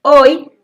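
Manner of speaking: a loud, emphatic demo of "hoy"; forceful HOY. Shown as a demonstration. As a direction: hit "hoy" hard as one strong syllable. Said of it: "se escucha la fuerza que toma la palabra".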